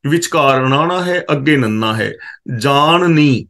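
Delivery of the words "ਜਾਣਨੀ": ਜਾਣਨੀ said with the retroflex ਣ in the middle and a plain ਨ before the final ਨੀ; careful pronunciation. Shown as a demonstration. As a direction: spell out ਜਾਣਨੀ slowly: ਜਾ-ਣ-ਨੀ. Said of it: "ਇਹਨੂੰ ਧਿਆਨ ਨਾਲ ਪੜ੍ਹਨਾ ਹੈ".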